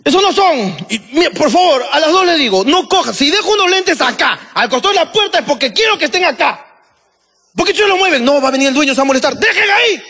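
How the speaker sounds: regaño exasperado en tono agudo; puteada a gritos. Retold or imitated. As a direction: reclamar furioso, subiendo hasta el grito. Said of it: "¿Y gritaste por las huevas?". (bronca aguda e indignada)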